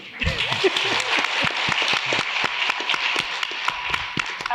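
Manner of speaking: loud burst of laughter; real laughter. Real, not acted. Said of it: "I'm laughing because".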